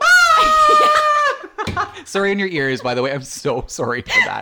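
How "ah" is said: piercing high scream instead of a bark; ear-splitting mock scream; dog scream. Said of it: "bark, it's like".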